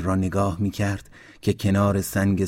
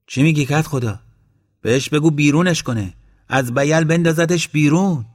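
higher, louder, heated objection; indignant protest; 6 semitones higher, 6 dB louder than usual. Acted. Esmail urging the headman, heated and higher. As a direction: push the headman to throw Abbas out.

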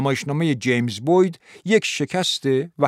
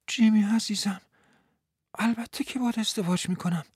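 higher, softer voice of a woman in love; gently voiced woman; 9 semitones higher, 4 dB quieter than usual. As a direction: promise him tenderly. Woman answers proposal.

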